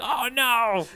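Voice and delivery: slow, high, hammed-up "oh no"; mock dread. Fan pretending horror at a top-decked card, slow and high.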